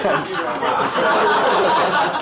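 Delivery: laughing through thanks; chuckling while thanking them. Real, not acted.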